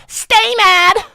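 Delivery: a mocking high-pitched yell; taunting squeal at the chat; high shouted taunt. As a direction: shriek it high and loud.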